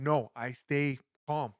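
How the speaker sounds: ordinary talk, nothing marked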